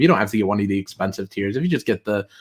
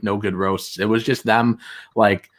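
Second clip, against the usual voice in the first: ordinary talk, nothing marked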